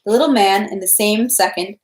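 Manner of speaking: ordinary delivery, nothing marked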